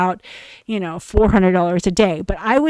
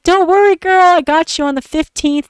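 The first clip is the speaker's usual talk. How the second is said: mocking boyfriend; 8 semitones higher, 6 dB louder than usual. Mocking boyfriend reassuring her, loud and high. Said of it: "And he's like".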